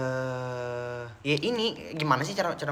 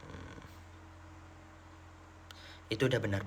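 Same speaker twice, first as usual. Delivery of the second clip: ordinary talk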